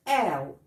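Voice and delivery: آو said as a gliding ow; ow sound. Shown as a demonstration. Teacher showing students how o plus u sounds. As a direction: glide the vowel into ow. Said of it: "فهو بينطق".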